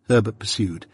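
ordinary delivery

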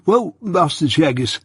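stuffy, snuffly voice of a man with a heavy cold. A narrator's reading. Mike answering Jaggers, stuffy with a chronic cold. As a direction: speak as if your nose is blocked. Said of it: "in the voice of a sufferer from a constitutional cold".

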